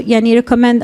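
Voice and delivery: ordinary talk, nothing marked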